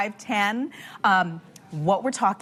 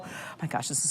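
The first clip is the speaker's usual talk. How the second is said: breathy whisper; 6 semitones lower, 11 dB quieter, much faster than usual. Real, not acted. Tempted by the bagel.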